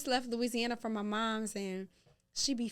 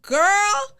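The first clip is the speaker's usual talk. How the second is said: put-on loud, higher-pitched warning to a child; mock parental scolding; 6 semitones higher, 14 dB louder than usual. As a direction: lay down the law loudly, retelling it. Parent retells scolding daughter.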